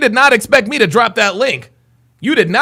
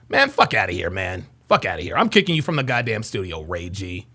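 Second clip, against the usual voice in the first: low, soft, fed-up muttering; quiet, deflated contempt; disgusted dismissal; 14 semitones lower, 9 dB quieter than usual. Real, not acted. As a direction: wave him away under your breath. Dismisses and kicks out challenger.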